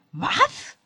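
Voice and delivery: high, loud shocked "Was"; aghast cry. A narrator's reading. Jenny cries out, aghast at Jean-Luc's news. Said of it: "rief ich entgeistert".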